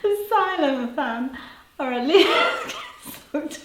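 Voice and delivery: high, slow words through laughter; barely holding it together; giggling. Real, not acted. Host reading chat while giggling, laughing.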